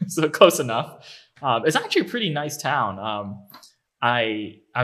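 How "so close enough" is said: laughing. Real, not acted. Laughs after being corrected.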